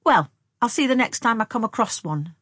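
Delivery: high, loud defiant promise. A narrator's reading. Young woman promising Tom she will kill a mole, defiant. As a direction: throw it at him as a dare. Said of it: "she promised defiantly".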